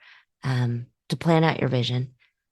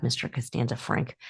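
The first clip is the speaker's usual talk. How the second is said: ordinary talk, nothing marked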